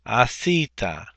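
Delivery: asita said slowly, syllable by syllable; slow model pronunciation. Shown as a demonstration. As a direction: spell out asita slowly for students. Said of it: "So you have asita".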